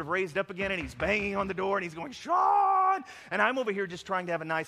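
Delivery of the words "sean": high drawn-out shout of a name; yelling. Acted. Zombie self banging on the door, yelling for him. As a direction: yell it loud and high, stretching the word. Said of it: "he's yelling over there".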